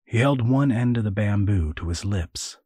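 ordinary talk, nothing marked

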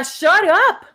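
acted-out telling-off; firm retold command. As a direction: tell her off sharply, acted out. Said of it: "Así yo le decía".

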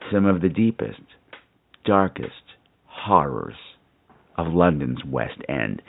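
deep, slow, creepy reveal; spooky storyteller. Acted. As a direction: sink into a creepy hush. Spooky build, dropping low.

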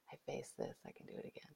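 fading to a whisper; whispered mantra. Shown as a demonstration. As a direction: repeat it softly to yourself. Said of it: "evidence that shows you".

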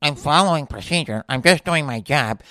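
mocking put-on voice of a rule-follower; lightly caricatured company drone; corporate dork. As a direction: imitate a dorky by-the-book employee. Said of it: "Corporate dorkerism is like".

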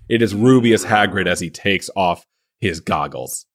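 ordinary talk

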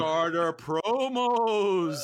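slow, drawn-out mock-horror moan; hammed-up eerie dread; spooky horror voice. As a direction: stretch it out like a campfire ghost story. Mock horror stories for board gamers.

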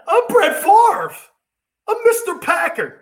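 hammed-up whiny quarterback; mock Favre whine. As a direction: puff it up like a pouting star. Mocking Favre again.

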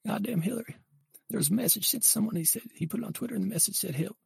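high-pitched impression of a politician confessing; high, put-on character voice; drawling political confessor. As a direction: pitch it way up, casually owning up to scandal.

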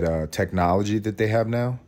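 normal talking voice

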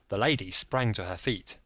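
usual speaking voice

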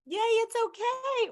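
high, slow, sugary cheer of "Yay"; cheerleader. Retold or imitated. Coach mocking a cheerleader coach, high and slow. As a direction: chirp it like a cheerleader who lets it slide. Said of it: "or are you a coach?".

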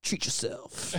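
soft unvoiced whisper; whispered catchphrase. Quoting Parks and Rec, soft whisper. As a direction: breathe the catchphrase. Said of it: "Do you watch Parks and Rec?".

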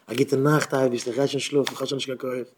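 soft, intimate bedtime prayer; quiet, worn-out murmur to God; tired good night. Acted. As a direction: say goodnight softly, out of strength. Acted bedtime words to God.

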